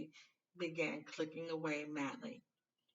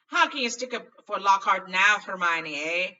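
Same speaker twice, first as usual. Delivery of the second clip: loud indignant call to the next room; calling through curtain; 4 semitones higher, 15 dB louder than usual. A narrator's reading. Ron calling out to Hermione behind the curtain, loud. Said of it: "Ron called through the curtain".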